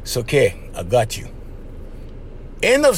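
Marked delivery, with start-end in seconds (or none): 0.0-1.3 low and soft, comforting her; tender low murmur; reassuring. Shown as a demonstration. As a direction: hold her and calm her, much lower and softer.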